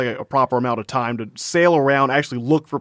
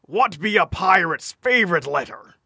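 pirate voice; 2 semitones higher than usual. Pretend. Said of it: "so I get my pirate voice on".

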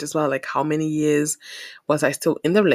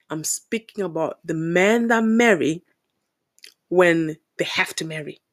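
ordinary delivery

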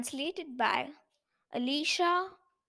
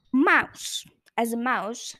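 usual speaking voice

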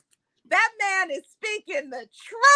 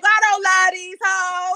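slow loud mock shout, voicing Rod; 4 semitones higher, 8 dB louder, slower than usual. Host voices Rod rejecting the ladies, slow mock shout. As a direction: boom it out slowly like Rod. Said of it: "Rod is like".

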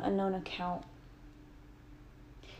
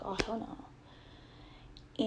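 very slow, much softer unvoiced whisper; whispered hell no; 7 dB quieter, much slower than usual. Shutting the door on her swinging mother, slow whisper. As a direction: drag it out under her breath.

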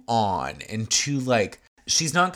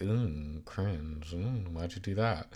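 soft, lower, wincing "ooh cringe"; cringing wince; 5 semitones lower, 9 dB quieter, slower than usual. Retold or imitated. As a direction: wince as if embarrassed for her. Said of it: "makes a face like".

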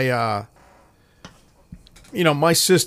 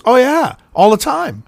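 eager; 9 semitones higher, 4 dB louder than usual. Retold or imitated. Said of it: "She goes".

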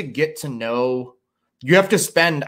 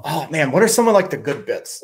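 ordinary delivery

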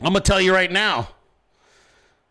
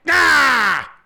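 long, loud, high "aah" of exertion; rage yell; 8 semitones higher, 7 dB louder than usual. Retold or imitated. Showing how he flexed on the scorpion, loud rage yell. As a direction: bellow as if flexing with all your might. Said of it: "I fucking flexed on him".

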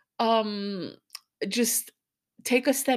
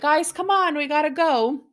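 raised, higher call to hurry everyone; imitated calling out; 5 semitones higher, 5 dB louder than usual. Dad calling the kids upstairs to leave, imitated calling out. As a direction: call up to them, louder and higher.

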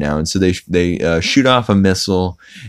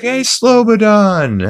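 high drawn-out greeting; 4 dB louder, slower than usual. Retold or imitated. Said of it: "he's like".

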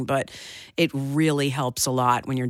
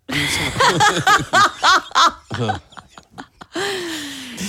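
high burst of laughter; loud laugh; 13 semitones higher, 5 dB louder than usual. Real, not acted. Big laugh at joke.

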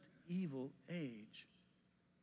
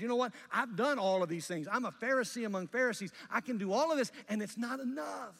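loud, higher, fast claim of his own record; Paul boasting loudly; 6 semitones higher, 9 dB louder than usual. Retold or imitated. Pastor voicing Paul about his past, loud fervent declaration. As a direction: proclaim it like a proud Pharisee. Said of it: "Paul says".

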